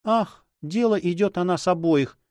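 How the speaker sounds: high, sighing woman's voice; whispering heroine. A narrator's reading. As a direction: voice a troubled lady, not truly whispered. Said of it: "прошептала она".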